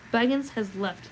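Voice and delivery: normal talking voice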